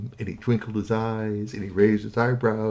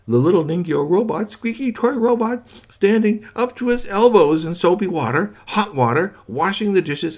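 excited storyteller; 10 semitones higher, 5 dB louder than usual. A narrator's reading. Excited description of robot.

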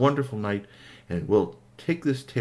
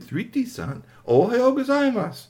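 cheerful robot greeting; 13 semitones higher than usual. Acted.

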